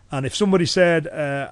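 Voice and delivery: normal talking voice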